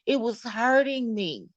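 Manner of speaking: ordinary talk, nothing marked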